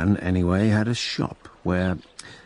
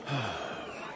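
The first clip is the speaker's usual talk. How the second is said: weary sigh; 5 semitones higher, 12 dB quieter than usual. Acted. Weary reply before "find me a horse.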